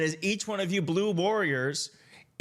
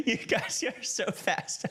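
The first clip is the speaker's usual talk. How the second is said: breathless laugh through the words; real giggling delight; 4 semitones higher than usual. Real, not acted. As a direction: laugh as you read the chat. Laughing at fast chat.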